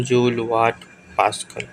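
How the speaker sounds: ordinary talk, nothing marked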